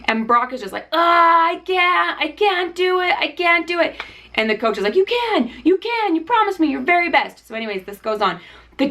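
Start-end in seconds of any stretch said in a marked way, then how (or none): 0.9-4.0 high, pleading cry of giving up; exhausted player imitation. Acting exhausted player pleading to coach. 5.0-7.5 high-pitched, insistent motivating coach; urging coach imitation. Acting coach urging the struggling player.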